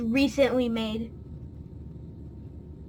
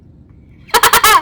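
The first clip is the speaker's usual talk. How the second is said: loud burst of laughter; 8 semitones higher, 10 dB louder than usual. Real, not acted.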